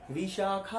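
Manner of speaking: Vishakha with the final खा held long, high and loud; stress on the vowel. Shown as a demonstration. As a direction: call out Vishakha, stretching the last आ. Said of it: "उस पर stress दे दीजिए".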